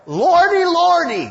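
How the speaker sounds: high, loud hammed-up church-lady cry; mock lordy lordy. Preacher parodying a flippant exclamation, high and loud. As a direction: wail it like a flustered old auntie. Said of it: "Or like".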